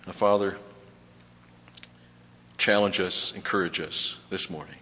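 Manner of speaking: steady, reverent pastor's prayer; earnest prayer. Acted. Pastor praying for the church before the study, reverent prayer voice. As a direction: pray it over the congregation.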